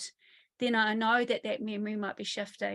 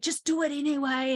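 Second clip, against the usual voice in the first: mock yell; 4 semitones higher, 4 dB louder than usual. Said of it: "and yelled at the kid".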